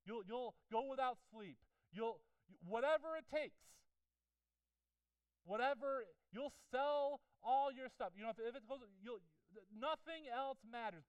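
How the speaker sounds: high, loud, slow, building sermon voice; fiery preaching. Acted. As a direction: preach it hard to the room. Preaching at full force.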